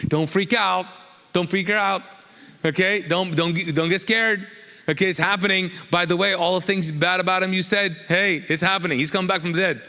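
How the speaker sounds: rally the room like a preacher in full flow; excited sermon peak, reassuring mourners; preaching at full force. Acted. Fired-up preaching of the resurrection.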